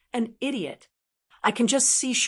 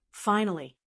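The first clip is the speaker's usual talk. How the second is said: plain reading voice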